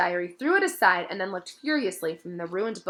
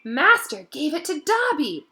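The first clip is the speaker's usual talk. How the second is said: higher, slow, awed elf voice; elf in wonderment; 8 semitones higher, 5 dB louder than usual. A narrator's reading. Dobby marvels that his master gave him a sock. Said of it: "in wonderment".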